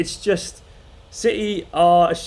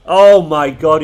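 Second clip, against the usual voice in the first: loud cry of disbelief; real exasperation at full volume; exasperated outburst; 12 dB louder, slower than usual. Real, not acted. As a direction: burst out much louder, appalled. Exasperated reaction to live match.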